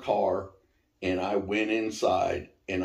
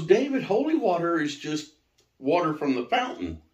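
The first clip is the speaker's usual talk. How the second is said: amused; 8 semitones higher than usual. Retold or imitated. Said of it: "she got to laughing at me".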